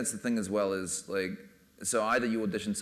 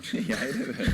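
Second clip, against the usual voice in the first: real laugh through the words, high; slower than usual. Real, not acted. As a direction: blurt it out while cracking up. Laughing answer about actor's reaction.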